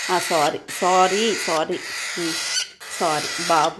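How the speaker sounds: slow, hushed apologies to a crying pet. Real, not acted. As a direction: coax gently, drawing out each sorry. Mother soothing crying pet.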